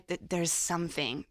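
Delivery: ordinary delivery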